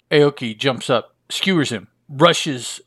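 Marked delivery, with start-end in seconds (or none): none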